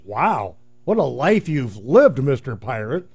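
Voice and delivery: ordinary talk, nothing marked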